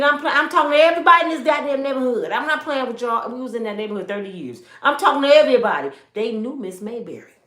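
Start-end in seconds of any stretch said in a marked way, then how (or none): 0.0-3.3 loud, fast street hollering; mother yelling at the whole neighborhood on the way home. Retold or imitated. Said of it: "yelling down the street". 4.8-5.9 loud, fast street hollering; mother yelling at the whole neighborhood on the way home. Retold or imitated. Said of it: "yelling down the street".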